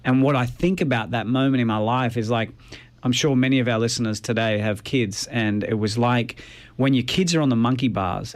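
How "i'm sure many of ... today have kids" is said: normal talking voice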